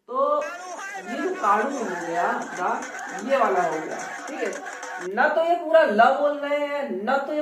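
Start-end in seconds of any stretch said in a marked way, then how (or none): none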